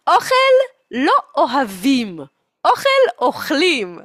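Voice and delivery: high, loud motherly decree; mock scolding mother. Mother correcting a picky eater, mock scolding. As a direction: lay down the law loudly. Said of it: "פה היא אומרת".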